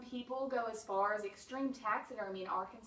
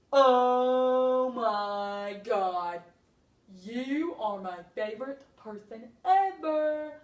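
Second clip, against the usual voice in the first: loud, slow, drawn-out gasp of delight; overjoyed to see you, stretching every word; gushing excitement; 11 dB louder, much slower than usual. Pretend. Stuffed raccoon greeting its favourite person, loud and gushing.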